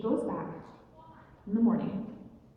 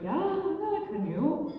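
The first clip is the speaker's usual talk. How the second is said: high-pitched, eager suitor; acted high-voiced young hopeful; Blue Jay; 8 semitones higher than usual. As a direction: answer the dad in a raised, swooping voice. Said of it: "And Blue Jay's like".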